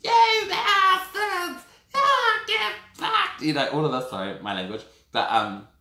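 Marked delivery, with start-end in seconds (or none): 0.0-3.4 shrieked, very high and loud, slow curses; crazy lady swearing. Retold or imitated. Imitating a crazy woman in the cells, screaming insults at officers. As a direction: screech abuse like an unhinged woman in the cells. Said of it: "she was just swearing at them".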